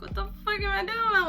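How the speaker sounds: high exasperated cry; exasperated outburst. Retold or imitated. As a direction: throw it up in despair. Exasperated self quote.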